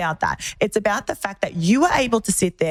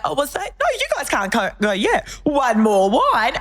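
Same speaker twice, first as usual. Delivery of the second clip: mock pushy friend; 6 semitones higher than usual. Mimicking friend urging to stay.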